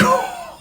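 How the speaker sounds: very high burst of laughter. Real, not acted. Delighted that zoom-out works.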